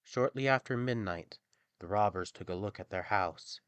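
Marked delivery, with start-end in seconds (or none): none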